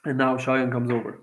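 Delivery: ordinary delivery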